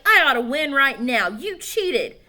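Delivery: put-on loud indignant kid; angry accusation. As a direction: accuse him hotly. Heated argument.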